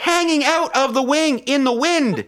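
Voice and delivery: high, comic climactic exclamation; incredulous hype. Real, not acted. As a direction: push the absurd image over the laughter. Absurd climax, friends laughing.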